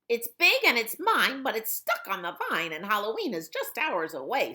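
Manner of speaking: high, loud cackling-witch voice, rushing at the end. Acted. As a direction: play the bossy witch claiming the pumpkin. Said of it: "said the witch".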